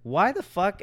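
ordinary talk

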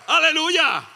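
high, loud laughing hallelujah; joyful shout. Real, not acted. Preacher celebrating before the church, loud and laughing. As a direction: burst out in joyful praise.

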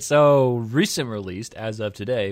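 ordinary talk

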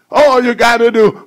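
ordinary talk

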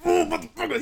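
high-pitched mock cry of pain; imitated pained cursing. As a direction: curse in a high voice, imitating someone hurt. Retells friend's reaction to ankle hit.

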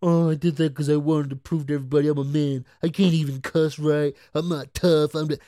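a put-on whiny confessional voice, lightly done; mock a celebrity crying about his feelings; mocking Will Smith. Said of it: "I, I can see it now".

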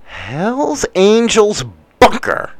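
slow, savoured reading of the phrase; mock formal reading. Host mocking the article's phrasing, slow and savoured. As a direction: relish the newspaper's wording. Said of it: "I love how they say this".

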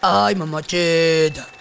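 slow, drawn-out flirty call; flirty drawl. Acted. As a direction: stretch it out playfully.

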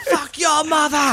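high slow Boston yell; Boston accent. Retold or imitated. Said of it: "speaking in my best Boston accent".

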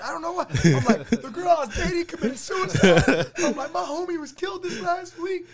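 high, loud laughing retelling. Retold or imitated. Teen telling gangster everything, laughing hard. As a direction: laugh while listing every trouble.

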